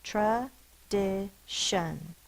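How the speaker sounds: "Tradition" very slow, in three separate beats; syllable by syllable. Shown as a demonstration. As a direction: break "Tradition" into tra, di, shun with pauses. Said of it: "Count the syllables in that".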